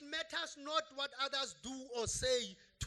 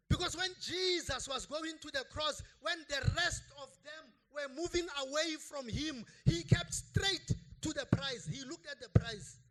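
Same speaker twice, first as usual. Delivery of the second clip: preaching build-up; 3 semitones higher, 7 dB louder than usual. Acted.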